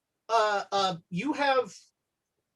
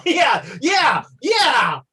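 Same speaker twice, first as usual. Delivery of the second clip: loud, laughing cheer; excited cheer; 7 semitones higher, 7 dB louder, much slower than usual. Real, not acted. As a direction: whoop it up at the joke.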